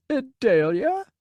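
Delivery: put-on high, wavering call of a frail old man; old man's quavering hopeful call; wavery old man. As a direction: call out hopefully, unsure it's her. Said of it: "wavery and uncertain".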